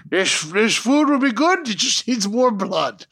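joking Scottish impression about bloody food; mock Scottish voice. Mocking Scots eating habits.

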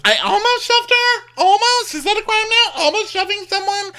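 very high, loud indignation; mock shrill Jen. Co-host imitating Jen denying the shove, squealing. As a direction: squeal the outrage. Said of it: "She goes".

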